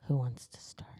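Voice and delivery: hushed, unvoiced whisper; whispered aside. Real, not acted. Host asks co-hosts who starts, whispered. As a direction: whisper it to the others off to the side.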